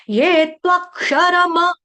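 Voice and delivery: ये त्वक्षरम with full क्ष, full र and full म, drawn out; slow full syllables. Shown as a demonstration. Teacher spells out त्वक्षरम sound by sound. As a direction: stretch त्वक्षरम evenly. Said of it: "क्ष का उच्चारण पूरा".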